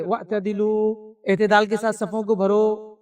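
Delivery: very slow Arabic call واعتدلوا, stretched out; imam's announcement. Retold or imitated. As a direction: intone it slowly like the imam at the Haram. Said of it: "تو یہ اعلان کرتے ہیں".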